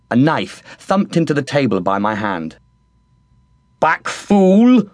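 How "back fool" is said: high, gruff cook's bark; head cook Bubble. A narrator's reading. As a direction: warn him off the king's food. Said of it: "said Bubble".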